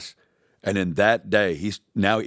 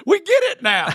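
mock disciples; 5 dB louder than usual. Mocking the disciples' reply.

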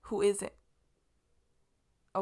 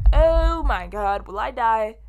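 slow, louder oh my God, hammed-up alarm; mock panic; 9 dB louder, slower than usual. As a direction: play it as comic fake terror. Realises she ate sanitizer.

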